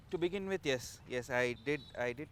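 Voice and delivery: ordinary delivery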